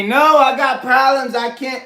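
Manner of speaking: mocking, slowed-down objection; mock protest. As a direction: imitate someone insisting on their troubles. Mimicking the viewer's repeated protest.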